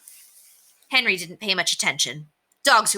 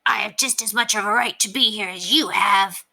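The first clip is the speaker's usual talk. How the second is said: indignant dog voice, pitched up; Ranger; 4 semitones higher than usual. A narrator's reading. Dalmatian answers Ribsy's challenge, in an indignant growling voice. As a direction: answer back as a growling, put-on dog character. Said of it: "Ranger's growl seemed to answer".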